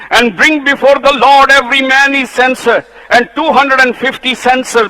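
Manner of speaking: high, loud pulpit fervour; preaching at full force. Acted. Preacher rousing the church against the rebels, fervently. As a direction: build the fire in the sermon.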